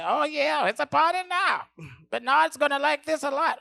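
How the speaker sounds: high, loud giddy voice; giddy excitement. Retold or imitated. Cat eyeing the cocaine, high loud giddy. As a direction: squeal with delight over the dope.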